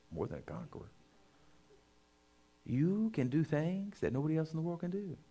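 mild, hushed encouragement to a husband; tender blessing. Shown as a demonstration. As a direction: speak life over your husband gently. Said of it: "walk up to your spouse and say".

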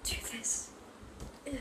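breathy unvoiced whisper. Real, not acted. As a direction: whisper it to yourself. Quiet aside while cleaning.

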